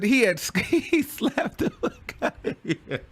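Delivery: loud, high, through laughter; cracking up. Real, not acted. Commentator reacting to the slap clip, cracking up. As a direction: burst out laughing mid-sentence.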